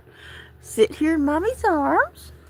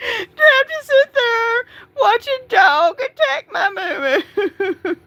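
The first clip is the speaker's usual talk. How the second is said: crying; 7 semitones higher than usual. Real, not acted. Cat just killed by dog.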